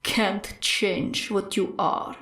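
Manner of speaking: low, slow, soft menace; a killer's taunt, low and slow; menacing villain voice. Acted. Stain's voice echoing again.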